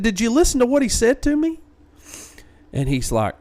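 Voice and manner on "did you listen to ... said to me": high, louder exasperated plea. Retold or imitated. Pressing Brett to listen to the audio, high exasperation. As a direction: make him hear it this time.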